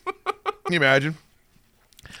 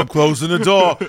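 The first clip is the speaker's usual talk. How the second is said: dramatic echo; 3 semitones higher, 5 dB louder than usual. Pretend. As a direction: repeat it with a swaggering finality. Echoes the same line.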